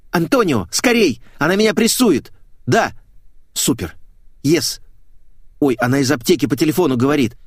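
high, urgent phone report; surprisingly brisk, perky. A narrator's reading. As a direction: fire it off in a high, hurried voice. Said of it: "на диво бойкий". (бойко, торопливо, высоким голосом)

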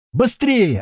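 high, loud urgent yell. A narrator's reading. Girl at the bunker door yells at them to hurry, high loud. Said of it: "орёт нам деваха".

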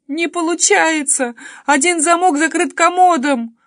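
high, loud tearful wail; wailing sobs. A narrator's reading. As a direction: cry it out in desperation. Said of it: "уже в голос рыдала я".

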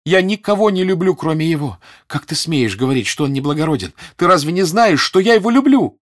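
high, loud, building to a peak; impassioned tirade, climbing to a cry; angry shouting. A narrator's reading. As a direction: shout out love in furious indignation. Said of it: "кричала Наташа".

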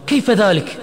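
loud, high, indignant question; angry shout. A narrator's reading. Friend reacting to Hasan's return to the mosque, angry shout. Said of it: "صرخ عماد غاضبا".

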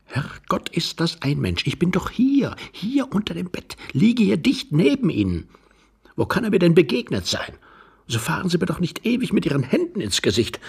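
high, hushed exasperation; irritated protest. Acted. Young man under the bed scolds his neighbour, exasperated. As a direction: protest sharply while staying hidden.

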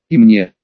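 мне pressed low and loud; stress on мне. A narrator's reading. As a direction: lean hard on мне. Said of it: "Последнее слово он произнёс с нажимом".

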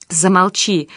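understated cry through tears; a restrained, narrated tearful outburst; tearful shout. A narrator's reading. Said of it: "кричал Павел сквозь слёзы".

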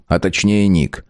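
ordinary delivery, nothing marked

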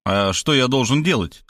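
usual speaking voice

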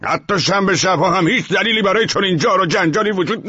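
very high, loud outburst; furious high-pitched comeback; angry shouted rebuttal. A narrator's reading. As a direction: shout the excuse down. Neighbour shouts down the tenant's excuse.

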